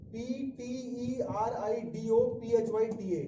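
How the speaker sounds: slow letter-by-letter spelling. Shown as a demonstration. Teacher spells pteridophyta for the class, slow spelling out.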